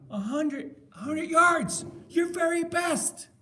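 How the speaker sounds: high-pitched, emphatic coach's cry; coach's amazed praise. Retold or imitated. Film coach to exhausted player, high rousing praise. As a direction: tell the player what he achieved, drawing out the end. Acting coach at end zone.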